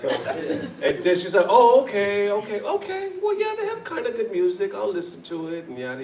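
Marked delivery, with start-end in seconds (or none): 1.5-6.0 imitated placated mom. Mom conceding to son after the explanation, high and placated. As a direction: back down, suddenly agreeable.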